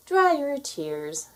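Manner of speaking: high, slow, gentle godmother voice; fairy godmother comforting Cinderella, high and slow. A narrator's reading. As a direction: soothe her as a kind fairy godmother.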